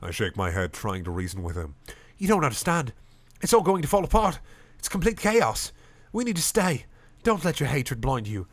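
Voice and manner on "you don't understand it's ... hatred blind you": pleading. Acted. Pleading with the crowd.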